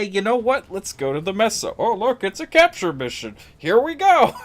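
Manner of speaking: a mock cheerful self-quote, high and loud; sing-song naive self; mocking his past self. Streamer mocking his own choice to chat. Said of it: "And then I say".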